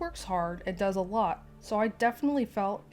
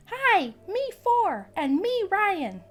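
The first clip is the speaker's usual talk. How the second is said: put-on high, slow toddler squeak; tiny child chirping hello; excited little kid voice; 11 semitones higher, 6 dB louder, much slower than usual. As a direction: play the four-year-old bursting to say hi. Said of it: "exclaims in an excited".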